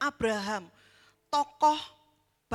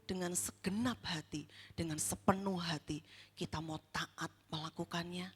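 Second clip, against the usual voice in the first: much softer, low searching preacher voice; hushed appeal; 7 semitones lower, 7 dB quieter than usual. Acted. Preacher quietly challenges the congregation to examine their obedience, hushed. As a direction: lean in and ask it quietly, almost whispered.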